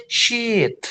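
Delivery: SHEET with a drawn-out ee, high; long vowel. Shown as a demonstration. Teacher showing the correct word, long high SHEET. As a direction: stretch the ee in SHEET. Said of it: "Harus panjang".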